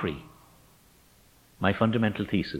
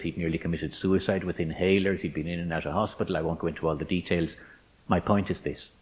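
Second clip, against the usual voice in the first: normal talking voice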